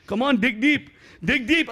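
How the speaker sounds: put-on spotter urging in an Indian accent. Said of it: "that accent is fucking dangerous".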